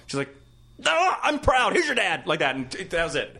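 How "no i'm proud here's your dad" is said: high, loud, curt brush-off; pissed-off mom. Retold or imitated. Mom asked if she's proud, high irritated dismissal. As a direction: mimic an irked mother handing off the phone. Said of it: "they're pissed".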